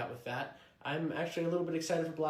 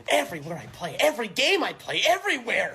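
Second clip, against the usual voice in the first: building to high, loud exasperation; exasperated rant; 8 semitones higher, 7 dB louder than usual. Acted. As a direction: get louder and higher with each complaint. Player ranting about bad luck.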